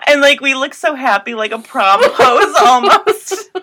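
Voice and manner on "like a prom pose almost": laughing. Real, not acted. Laughing through funny memory.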